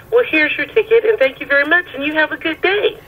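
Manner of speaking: mock-pleasant send-off, climbing high; imitating cheerful judge. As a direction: hurry them off with high, singsong courtesy. Voices judge sending her off.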